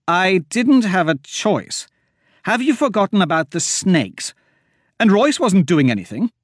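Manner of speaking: high, loud, slowed defense; high indignant protest; defensive snap. A narrator's reading. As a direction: push back hard. Myra defending herself heatedly.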